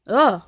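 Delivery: loud frustrated grunt; short burst of exasperation; exasperated groan. Real, not acted. Boyfriend fed up with being guilt-tripped, exasperated groan.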